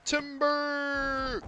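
high, drawn-out call of timber. Acted. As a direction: yell it like a lumberjack. Calls out as tree falls.

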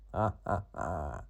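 slow low villain laugh; sinister laugh. Acted. Cat laughs at frog, slow sinister laugh. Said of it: "зловісно сичав кіт".

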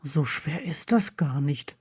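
low, soft mutter; murmured. A narrator's reading. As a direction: mutter it absently. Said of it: "murmelte er".

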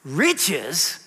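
incredulous scoff at riches; mild mock disbelief. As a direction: sneer at the word. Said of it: "it's like this".

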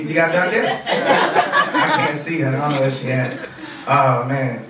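ordinary talk, nothing marked

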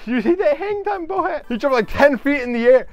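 high, thrilled exclamation; amazed excitement. Real, not acted. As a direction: marvel at an incredible play. Amazed at a diving shot.

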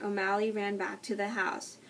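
plain reading voice

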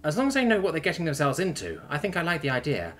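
agreeable Terran woman. Acted. Terran agreeing to her lover's idea, considering.

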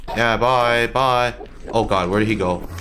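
usual speaking voice

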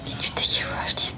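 ordinary talk, nothing marked

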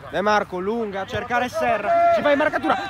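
normal talking voice

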